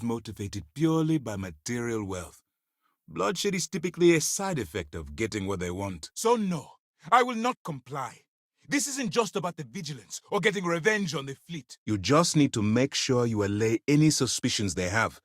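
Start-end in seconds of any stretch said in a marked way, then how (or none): 6.1-11.8 high-pitched, insistent protest; heated refusal. Acted. Officer refusing to comply over Vigilance, heated. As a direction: refuse point-blank, voice raised high.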